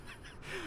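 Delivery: high, breath-light chuckle; soft giggle. Real, not acted. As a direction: giggle quietly to yourself. Amused by the discovered key.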